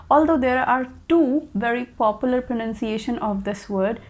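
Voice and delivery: ordinary delivery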